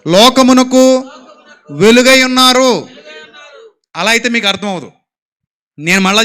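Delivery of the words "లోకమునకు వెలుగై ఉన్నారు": loud, slow call-and-response; loud together. Shown as a demonstration. Preacher leads the crowd in a loud, slow chant. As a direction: shout it slowly with the crowd. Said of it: "గట్టిగా చెప్పండి నాతో పాటు".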